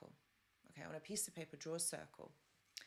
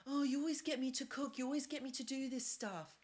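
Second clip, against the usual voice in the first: higher, mock whining nag of a complaining sister; whiny sister; 7 semitones higher, 3 dB louder than usual. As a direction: imitate the sister moaning, pitched up.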